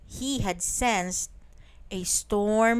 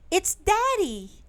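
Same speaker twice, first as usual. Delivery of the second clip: high excited call, raised voice; shouted; 10 semitones higher than usual. Acted. Boy spots his father through the storm, high excited shout. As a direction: call out to him, thrilled and urgent. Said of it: "he shouted".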